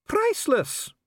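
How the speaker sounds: very high-pitched, affronted exclamation; insist, scandalised by the question; indignant insistence. Acted. Official insists on sacred relic's worth, indignant. Dee insists on the scone's value.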